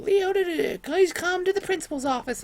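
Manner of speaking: very high, squawky imitation of a PA; intercom announcement. Intercom summons him to the principal's office, imitated squawk. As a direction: mimic a tinny intercom page. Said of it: "a little intercom inside the gym".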